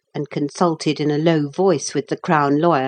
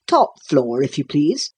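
courteous instruction with a lifted ending; lightly voiced courteous jailer; polite. A narrator's reading. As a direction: usher them up politely. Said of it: "said the jailer politely".

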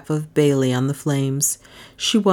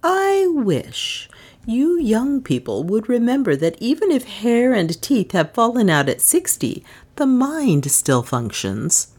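enjoying it; 7 semitones higher than usual. A narrator's reading. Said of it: "obviously enjoying the situation to the full".

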